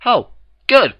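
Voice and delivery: a hint of embarrassed gossip; lightly voiced baker. A narrator's reading. The Cakes admit they only heard it secondhand.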